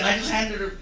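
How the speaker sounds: ordinary talk, nothing marked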